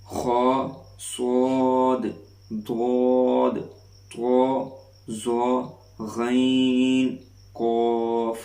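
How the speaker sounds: خا صاد ضاد drawn out slowly and full; stretched heavy letters. Shown as a demonstration. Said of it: "وہ یہ ہیں".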